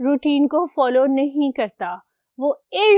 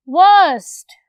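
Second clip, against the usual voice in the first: emphatic reveal; 4 semitones higher, 7 dB louder, slower than usual. Shown as a demonstration. Teacher reveals irregular superlative.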